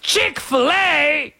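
very high, very slow hammed-up British; mock British accent. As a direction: drag out Chick-fil-A in a bad British accent. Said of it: "My accents are awful".

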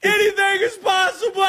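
high, full-throated victory scream; Kevin Garnett yell. Retold or imitated. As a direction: scream it out, stretching the last word. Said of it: "You gotta say".

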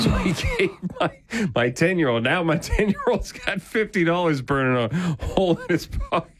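talking through high-pitched laughter; amused, laughter breaking into the words; laughing. Real, not acted. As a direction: laugh your way through the story, voice jumping up. Host laughing while retelling the payoff.

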